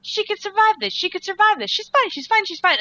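high, loud frantic reassurance; desperate hope. Retold or imitated. Telling herself the falling heroine survives, frantic hope.

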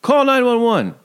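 high, urgent retold yell; mock yell. Host acts out Peter calling for help, mock yell. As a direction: call it out high like an alarm. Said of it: "And then he yells".

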